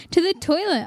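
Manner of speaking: usual speaking voice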